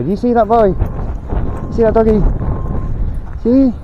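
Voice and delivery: talking to the dog. Real, not acted.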